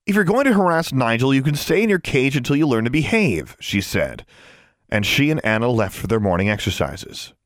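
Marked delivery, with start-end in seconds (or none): 0.0-3.6 pitched-up telling-off; stern scolding. A narrator's reading. Girl to her caged raven, scolding sternly. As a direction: lay down the law to a pet.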